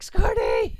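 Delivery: high, loud comic yell; calling out. Acted. Player hailing teammate mid boss fight, dramatic yell. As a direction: yell a friend's name in mock drama.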